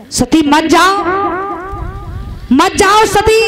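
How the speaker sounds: pleading cry. Acted. Shiva begging Sati not to go.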